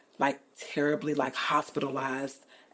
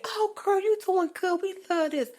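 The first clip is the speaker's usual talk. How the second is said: much higher, louder gushing praise; mock sweet compliment from fans; 4 dB louder than usual. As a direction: gush like a fan being polite. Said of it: "people can say".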